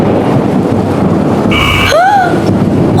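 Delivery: sharp frightened gasp; loud shocked gasp. Acted. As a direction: gasp in alarm. Shock at danger.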